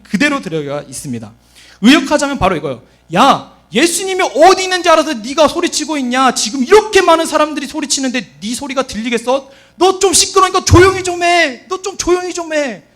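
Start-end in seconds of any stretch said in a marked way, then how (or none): 3.1-12.8 loud, rising rebuke; acted crowd member shushing a nuisance; scolding. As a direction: tell someone off sharply, voice climbing. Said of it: "꾸짖고 꾸짖고 꾸짖고 책망한 거예요".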